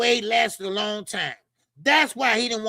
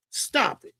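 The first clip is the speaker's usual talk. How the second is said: ordinary delivery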